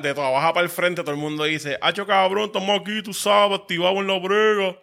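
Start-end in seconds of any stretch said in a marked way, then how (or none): 1.8-4.8 lightly voiced slurred street guy, a bit higher; half-stroke caco voice. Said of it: "con voz de medio derrame".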